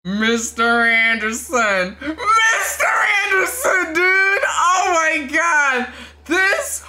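high shouting with a cry in it; overjoyed shout. Real, not acted. Fan crying out at the famous line. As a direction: freak out with joy.

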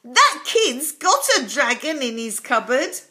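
slow, loud exclamation; a panicked postwoman shouting; yelling postwoman. A narrator's reading. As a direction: yell it in alarm. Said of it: "she yelled".

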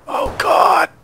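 scared high cry; panicked shout. Real, not acted. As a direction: yelp it in sudden fear. Spotted by gunman.

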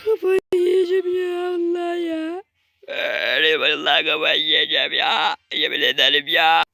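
mock crying. Fed up with the game.

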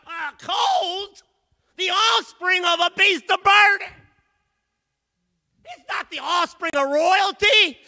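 loud, high preaching, slowing the key words; shouted sermon climax; full-force preaching. Acted. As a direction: preach it out to the whole church.

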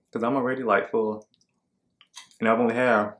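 talking with mouthful; snacking while speaking; chewing popcorn. Real, not acted. Seen 2: Eats popcorn pieces, hand to mouth at 3.2, jaw chewing between phrases.

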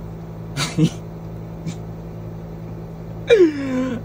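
high, breathless unvoiced laughter; wheezing laugh that will not stop; laughing fit. Real, not acted. Cracking up telling friends the story, high wheezing laughter.

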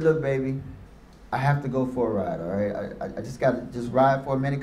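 low and soft, holding back tears; subdued hurt. Retold or imitated. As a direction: keep it quiet so she won't hear you break. Hurt father hiding tears.

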